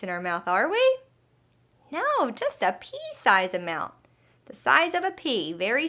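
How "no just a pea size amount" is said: plain reading voice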